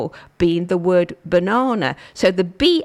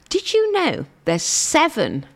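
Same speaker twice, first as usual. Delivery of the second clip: usual speaking voice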